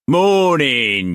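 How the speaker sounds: loud, high mock-polite greeting; cocky pirate greeting. A narrator's reading. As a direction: greet the officers with mock deference. Pirate figure voice.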